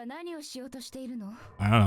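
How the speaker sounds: high, slow, sing-song teasing. Pretend. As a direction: draw it out high and playful. Teasing reply to a playful question.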